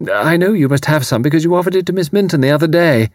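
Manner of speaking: high, gushing apologetic lady; flustered and foolish. A narrator's reading. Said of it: "a flustered but foolish face".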